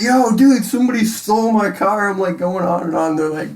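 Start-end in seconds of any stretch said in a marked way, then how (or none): 0.0-2.1 high, loud panicked yell; mock frantic cry for help. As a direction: freak out about the stolen car. Panicked call about stolen car.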